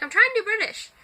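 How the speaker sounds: high-pitched protest; mock protest. Vlogger mocking their own failed British accent, high-pitched protest. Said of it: "I'm just like".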